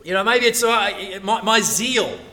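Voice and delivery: ordinary delivery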